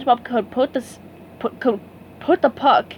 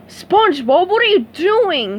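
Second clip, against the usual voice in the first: higher, louder crabby boss shout; angry yell; 8 semitones higher, 5 dB louder than usual. A narrator's reading. Mister Krabs catching SpongeBob, angry yell. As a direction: bark at his fry cook. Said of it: "he yelled".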